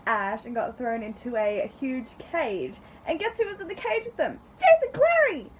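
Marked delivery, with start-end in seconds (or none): 3.1-5.5 building up to a high, loud shout of the name; gleeful surprise announcement, pitch soaring; excited reveal. Real, not acted. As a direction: climb to a thrilled squeal on the reveal.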